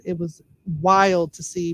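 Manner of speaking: usual speaking voice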